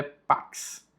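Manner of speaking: pax with the x said as k s, paks; Latin pronunciation. Shown as a demonstration. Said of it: "O X em latim tem a pronúncia de K S".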